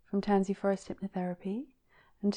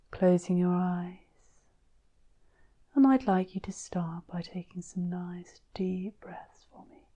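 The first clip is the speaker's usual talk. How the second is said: guided sleep hypnosis. Acted.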